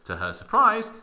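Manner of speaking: ordinary talk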